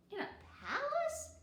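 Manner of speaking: put-on very high disbelieving kid; can't believe it; surprised child. A narrator's reading. Kiko surprised.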